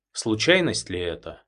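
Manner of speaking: ordinary delivery, nothing marked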